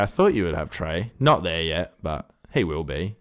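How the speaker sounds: ordinary delivery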